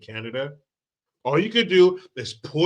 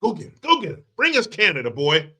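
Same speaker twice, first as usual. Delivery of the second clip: mock rallying crowd demand, a touch higher; egging Trump on like a crowd; lightly voiced crowd urging the president; American people demanding; 3 semitones higher than usual. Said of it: "the American people said".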